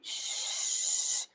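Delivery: long whispered shushing sound; soft, drawn-out "shh"; hushed shh in the ear. Retold or imitated. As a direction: imitate a ghostly shush at the ear. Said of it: "me dicen al oído así".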